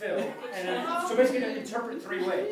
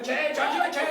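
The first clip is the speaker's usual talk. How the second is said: raised, higher holler back; yelling back; 6 semitones higher, 5 dB louder than usual. Retold or imitated. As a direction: call back to someone far away. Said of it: "The guy yells back".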